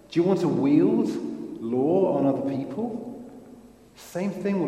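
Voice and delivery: loud, high, fervent preaching; preaching at full force. Real, not acted. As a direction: drive it home to the congregation, loudly.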